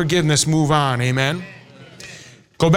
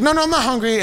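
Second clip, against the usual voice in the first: a hint of a protesting kid, higher pitch; imitated refusing child; 6 semitones higher, faster than usual.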